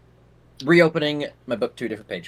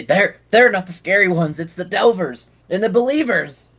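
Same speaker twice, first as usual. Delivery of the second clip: fearful; 6 semitones higher, 5 dB louder than usual. Acted. Frightened man explains to party.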